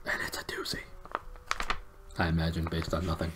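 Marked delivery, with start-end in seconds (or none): 0.1-0.8 spooky whispered teaser. Pretend. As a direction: breathe it like a secret. Whispered teaser before the chapter.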